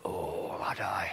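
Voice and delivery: hushed whisper in bed. Retold or imitated. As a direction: whisper like lying awake at night. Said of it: "laying in bed".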